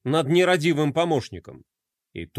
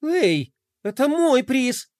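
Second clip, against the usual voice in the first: indignant protest; 7 semitones higher, slower than usual. Acted. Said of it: "возмутилась Глория".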